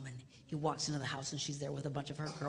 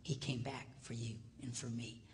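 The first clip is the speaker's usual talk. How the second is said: ordinary talk, nothing marked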